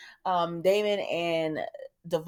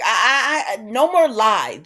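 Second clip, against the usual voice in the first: loud, higher stammered protest; retold outburst; 4 semitones higher, 7 dB louder, slower than usual. [name] interrupting Kane's explanation, mock indignant. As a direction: imitate cutting someone off, indignant.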